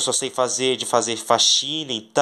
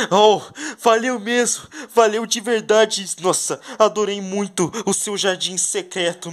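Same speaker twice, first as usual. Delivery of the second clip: higher, thrilled thanks; grateful character voice; 8 semitones higher than usual. Pretend. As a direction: gush with gratitude.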